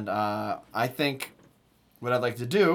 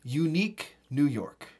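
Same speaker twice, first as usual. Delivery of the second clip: slow, careful Unique New York; slow tongue twister demo; slower than usual. Shown as a demonstration. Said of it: "a classic".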